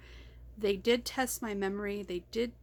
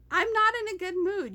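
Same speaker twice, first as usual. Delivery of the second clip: higher, louder irritated outburst; annoyed; 10 semitones higher, 7 dB louder than usual. Real, not acted. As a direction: snap it out in real annoyance. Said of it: "but I'm mad".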